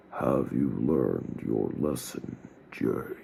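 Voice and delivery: slow, low booming figure; deep, slow voice from the dark. A narrator's reading. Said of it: "in a loud, booming voice".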